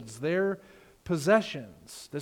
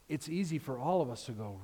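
ordinary delivery

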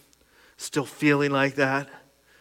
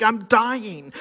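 mock panic; 8 semitones higher, 5 dB louder than usual. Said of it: "All my mind could make up was like".

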